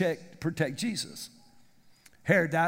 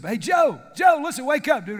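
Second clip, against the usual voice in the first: louder, higher call to wake him; angel calling out; 5 semitones higher, 7 dB louder than usual. Acted. Gabriel calling sleeping Joe awake, louder and higher. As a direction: shout him awake, mock urgent. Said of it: "Gabriel shows up".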